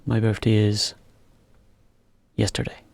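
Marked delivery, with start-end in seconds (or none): none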